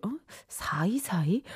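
hushed baffled question to oneself; very soft, confused echo; puzzled whisper. Retold or imitated. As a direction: whisper it, trying to work it out. Puzzled part-timer muttering to self.

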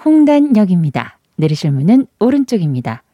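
loud, clear station announcement; subway announcement. Shown as a demonstration. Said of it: "잘하네".

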